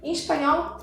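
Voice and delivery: ordinary delivery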